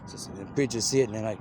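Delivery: usual speaking voice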